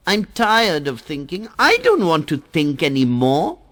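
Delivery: high, petulant water-drop voice, sulking; sulky, bored complaint, voiced high; in a huff. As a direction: whine it like a fed-up child. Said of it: "Goes away in a huff".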